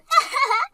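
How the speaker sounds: gloating little laugh; smug laugh. Acted. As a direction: laugh with mean delight. Daughter-in-law gloats over cutting ties.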